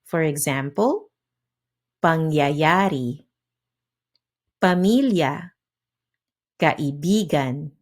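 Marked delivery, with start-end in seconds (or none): 2.0-7.7 "pangyayari, pamilya, kaibigan" each stressed on second-to-last syllable; penultimate stress. Shown as a demonstration. Teacher giving learners examples, "pangyayari, pamilya, kaibigan" with second-to-last syllable stressed. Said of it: "stressed on the second to last syllable".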